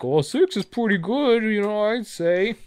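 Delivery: high, slow mocking voice; mock her high and drawn out; imitating Bulma. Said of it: "she was like".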